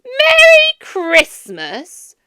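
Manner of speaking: loud, high, drawn-out sneer; mock the words slowly; mocking Scrooge. A narrator's reading. Scrooge mocks the greeting.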